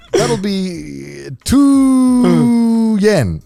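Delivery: high voice with hugely stretched "two"; imitating old driver. Old taxi driver announcing the fare, high drawn-out imitation. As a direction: hold "two" absurdly long, like an old driver. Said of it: "He'd be like".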